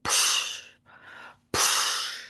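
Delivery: soft unvoiced psh like a wave breaking; wave swoosh sound. Coach imitating ocean waves breaking, soft swoosh. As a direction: mimic the waves crashing in, breathy. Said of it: "ocean waves where they're just like".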